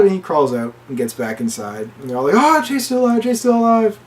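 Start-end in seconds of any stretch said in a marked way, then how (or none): 2.4-4.0 high-pitched retold exclamation; mock amazement. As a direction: imitate astonished friends on seeing a survivor. Partygoers see Jay crawl back.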